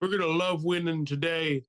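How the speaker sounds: put-on speechmaker's voice, pitched high; mock political speech. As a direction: proclaim it like a feeble rally speaker. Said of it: "I wanna hear the speech".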